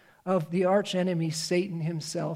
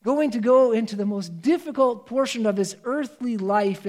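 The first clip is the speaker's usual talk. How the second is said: normal talking voice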